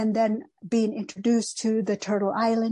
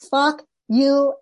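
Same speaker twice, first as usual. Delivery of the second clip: louder, higher indignant outburst; retold rage; 4 semitones higher, 5 dB louder than usual. Repeating the unspoken comeback to her, enraged. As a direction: punch out each word in retold fury. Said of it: "filled with a rage".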